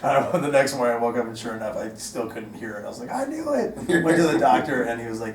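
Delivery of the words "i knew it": high-pitched, soft exclamation; mock vindication. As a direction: cry it out in mock dismay, kept small. Acting out his own vindication.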